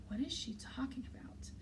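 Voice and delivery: whispered aside to oneself; hushed, bewildered whisper; puzzled whisper. A narrator's reading. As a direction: whisper it as a baffled private thought. Narrator's puzzled private thought, whispered.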